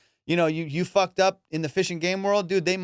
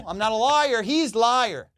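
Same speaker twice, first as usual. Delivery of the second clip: worked-up protest; 5 semitones higher, slower than usual. Retold or imitated. Angler blaming his buddy before officers, worked-up protest. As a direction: accuse your buddy in acted outrage. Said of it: "got to the point where I was like".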